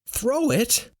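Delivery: high-pitched echo, rising in doubt; incredulous high echo; disbelieving question. Acted. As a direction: repeat the order back in disbelief.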